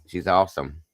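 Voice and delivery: ordinary talk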